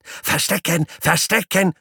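very high squealing call; squeaky guinea pig call. Acted.

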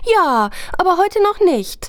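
put-on high, eager child voice; a small boy begging for a story; kleiner Junge. Junge widerspricht. (hohe Kinderstimme, bittend)

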